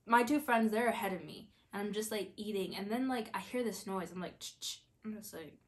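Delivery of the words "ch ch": imitated rustle. Imitates the rustling noise she heard.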